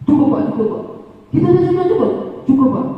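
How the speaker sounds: put-on high, laughing child's voice; laughing pupil. Pupil telling teacher an apology is enough, laughing. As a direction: giggle it out like a relieved boy.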